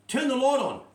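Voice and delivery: high, loud call; calling for the light. Retold or imitated. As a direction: call across the room. Calling across dark bar.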